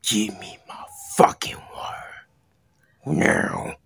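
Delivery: whisper, threatening; menacing whispered demand. Acted. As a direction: hiss the threat low and close.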